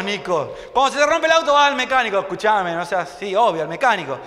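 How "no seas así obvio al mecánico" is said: normal talking voice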